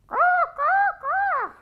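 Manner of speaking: high cawing crow call; squawking crow caws. Acted. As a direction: caw like a crow.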